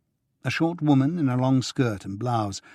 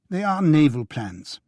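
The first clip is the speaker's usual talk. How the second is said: grudging; 5 semitones higher, 5 dB louder than usual. A narrator's reading. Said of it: "she said grudgingly".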